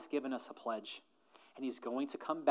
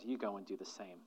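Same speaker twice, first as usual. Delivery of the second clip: low and much softer, a gentle charge; soft lower command, go and do the same; quiet command; 6 semitones lower, 11 dB quieter, faster than usual. Retold or imitated. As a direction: hand him the task quietly. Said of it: "And then Jesus said".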